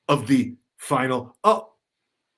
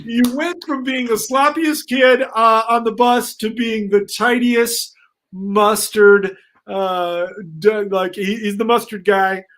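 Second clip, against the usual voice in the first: high, loud emcee hype; big stage announcer energy; 7 semitones higher, 7 dB louder than usual. Acted. Headmaster announcing at talent show.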